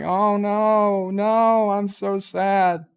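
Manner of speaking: high, loud hammed-up wail; mock lament. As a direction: wail like a dramatically wounded kid. [name] laments being told off.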